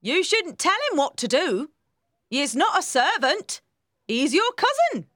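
high, loud, emphatic housekeeper voice; scolding housekeeper. A narrator's reading. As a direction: set the young miss straight, pitch up.

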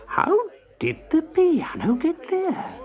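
high, slow, bewildered question; astonished. A narrator's reading. Husband finding his piano in the street, astonished. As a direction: ask it haltingly in disbelief.

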